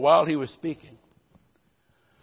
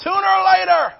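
much higher, loud preacher's shout; 12 dB louder than usual. Acted. Pastor hitting the climax to the church, loud shout. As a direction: belt it out as the punchline.

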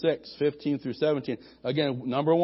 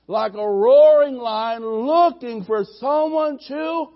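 high, loud preacher's roar building on the devil; fiery pulpit warning, high and loud; preaching at full force; 6 semitones higher, 6 dB louder than usual. Acted. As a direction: thunder the warning about the enemy.

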